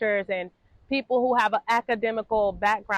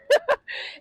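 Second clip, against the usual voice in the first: sudden high giggle bursting out; high burst of laughter. Real, not acted. Vlogger laughing at herself mid-sentence, high giggle.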